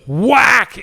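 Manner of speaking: sharp imitated crack of a ground ball; sound effect whack. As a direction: punch out the hit like a ball smacking bone. Ball hits A-Rod.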